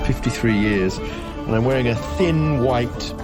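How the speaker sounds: ordinary talk, nothing marked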